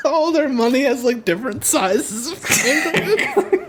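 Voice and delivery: high giggly voice, cracking up mid-sentence; words bubbling with laughter at the joke; laughing. Real, not acted. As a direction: talk through real laughter. Giggling at own kangaroo joke.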